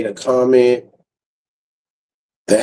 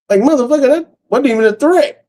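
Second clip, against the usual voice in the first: exasperated; 12 semitones higher than usual. Real, not acted. Streamer insisting to viewers it wasn't a threat, high exasperation. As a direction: throw your hands up in disbelief.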